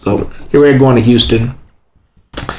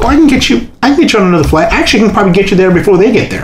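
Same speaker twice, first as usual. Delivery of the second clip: higher, eager helpful lady; lightly voiced gate agent; 9 semitones higher, much faster than usual. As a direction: offer help brightly like a ticket agent. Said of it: "It's like".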